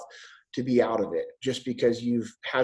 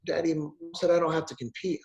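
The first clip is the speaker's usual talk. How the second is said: put-on teary little girl; 2 semitones higher, 4 dB quieter than usual. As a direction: plead like a teary seven-year-old. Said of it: "She's like".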